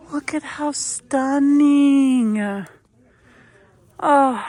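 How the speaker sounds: gasp, then drawn-out slow marvel; breathless wonder at sparkly fabric, slowed right down; gasping awe. Real, not acted. Shopper gasps at stunning fabric, slow awed delight.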